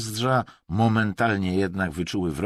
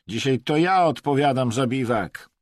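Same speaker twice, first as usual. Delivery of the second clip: raised, loud self-assertion; insolent; 6 semitones higher, 6 dB louder than usual. A narrator's reading. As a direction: claim the job brazenly. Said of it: "bezczelnie przerwał mu". (bezczelnie, podniesionym głosem)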